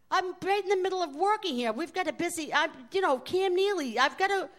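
exasperated waitress pleading she's swamped; flustered protest. Retold or imitated.

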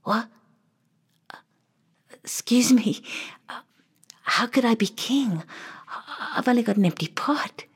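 timid, hushed disbelief; stunned whisper. Acted. Boy whispers his confusion to the king. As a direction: whisper it as if you can't believe it. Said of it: "boy answers king".

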